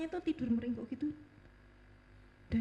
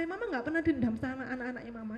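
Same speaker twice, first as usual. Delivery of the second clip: ordinary talk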